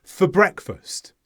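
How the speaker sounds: "For breakfast" merged as fuh-breakfast; linked words. Shown as a demonstration. Teacher repeats the linking, "For breakfast" like one word. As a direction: weaken "For" and join it onto "breakfast". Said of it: "it's like all one word".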